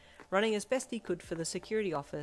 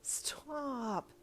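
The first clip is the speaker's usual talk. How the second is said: high, stretched-out whine; drawn-out, high protest; embarrassed wail; 9 semitones higher, 3 dB quieter than usual. A narrator's reading. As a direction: wail it, mortified. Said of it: "you wailed".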